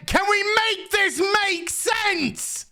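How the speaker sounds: slow, high exasperated whine; high drawn-out plea. Real, not acted. Reviewer begging the show to make sense, high slow whine. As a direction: stretch it out high like you're begging.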